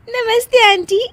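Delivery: high giggle into a polite hello; giggling greeting. Acted. Daughter-in-law greeting mother-in-law's friends, giggling. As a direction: greet while holding in laughter. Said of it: "हंसने वाली दवा खा लेती है".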